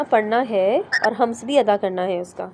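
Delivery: ordinary talk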